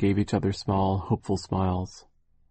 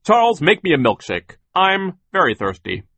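high, loud, imperious little boss; bossy Sir; 6 semitones higher, 8 dB louder than usual. A narrator's reading. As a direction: order him about, loud and high. Sir demands a milkshake.

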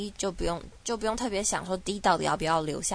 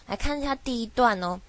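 ordinary delivery

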